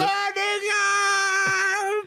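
high, slow wailing cry; panicked cry. Acted. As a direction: wail in mock panic. Astronauts burning up.

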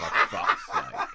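laughing groan. Retold or imitated. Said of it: "I was like".

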